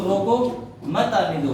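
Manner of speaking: "रोको" alone, a pause, then "मत आने दो" together; pause after रोको. Shown as a demonstration. Teacher explaining to stop him, pause after "रोको". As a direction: break right after "रोको", keep "मत" with "आने दो". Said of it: "मतलब क्या हुआ कि उसे रोक लो".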